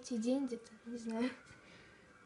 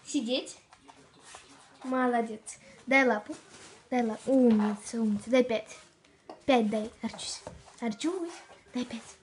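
loud dog commands; 7 dB louder than usual. Real, not acted.

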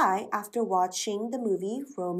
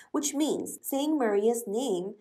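ordinary talk, nothing marked

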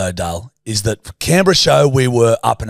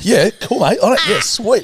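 high, hurried sheepish okay; backpedal, high and eager to leave; meek agreement; 10 semitones higher than usual. Retold or imitated. Retold meek agreement after rebuff.

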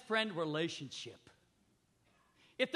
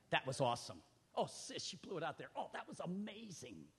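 softer, lower warm praise; gentle encouragement; 4 semitones lower, 6 dB quieter than usual. Retold or imitated. Encouraging a sister after singing, soft praise. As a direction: quietly build her up.

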